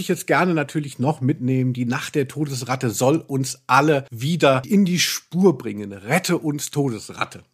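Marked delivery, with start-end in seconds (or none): none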